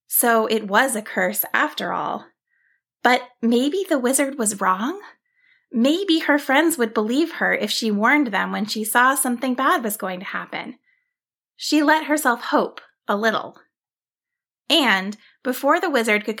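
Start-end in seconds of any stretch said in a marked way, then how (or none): none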